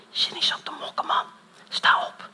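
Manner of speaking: hushed, voiceless inner voice; urgent whisper of the Holy Spirit. Retold or imitated. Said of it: "tot je fluistert".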